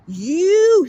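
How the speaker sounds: "you" stretched long, high and loud; imitated heavy emphasis on "you"; stressed you. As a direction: swoop up on a drawn-out "you". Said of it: "he stressed the word you".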